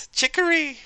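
"Chicory" squealed very high; squeaky delight. Acted. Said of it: "I love that word".

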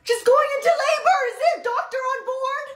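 high, alarmed call for a doctor; urgent call. Acted. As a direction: call out across the cabin in alarm. Passenger calls for help.

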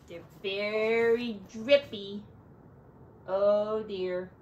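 plain reading voice

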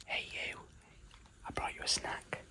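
soft unvoiced whisper to a pet. Real, not acted. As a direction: whisper so you don't startle her. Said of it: "Got to keep her fed".

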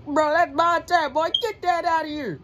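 high, loud outraged shout; angry yell. Real, not acted. Gamer shouting at his opponent, an outraged high yell. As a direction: yell at the screen.

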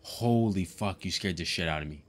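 normal talking voice

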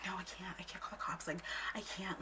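soft imitated whisper; whispery refusal. Imitating the friend refusing to call the cops, whispery. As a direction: refuse in a hushed little voice. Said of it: "she's, like, whispering".

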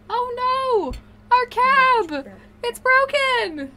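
high, slow theatrical cry; dramatic wail. Acted. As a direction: wail it like a tragedy. Hammed-up repeat of chat quote.

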